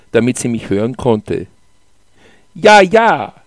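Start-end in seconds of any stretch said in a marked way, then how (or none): 2.5-3.3 high, loud shouted reply; almost shouting. A narrator's reading. Mother answering child over the speeding lift, near shout. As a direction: nearly shout it back so it carries. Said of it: "Sie schrie es beinahe".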